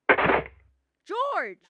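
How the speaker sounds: loud high call across the room. Acted. Mary calling George over to the phone, loud. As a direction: shout his name to fetch him.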